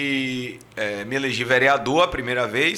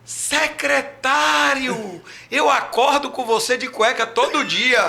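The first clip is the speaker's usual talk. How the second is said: high, slow, drawn-out mock fan voice; imitating a bar patron; 11 semitones higher, much slower than usual. A man at a bar greets the secretary, high and slow. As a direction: stretch out the stranger's greeting, pitched way up. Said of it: "Aí o cara olhou pra mim".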